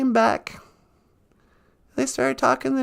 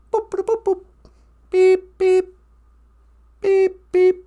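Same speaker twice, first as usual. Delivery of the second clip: mock device beeps; 6 semitones higher, 3 dB louder, slower than usual. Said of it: "imitates teleport device beeps".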